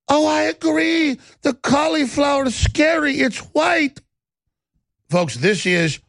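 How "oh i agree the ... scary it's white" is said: high, hammed-up hysterical cry; mock her hysterical panic. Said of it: "hysterically going".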